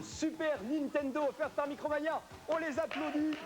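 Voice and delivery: booming prize announcer. Acted. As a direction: boom out the prize to the crowd.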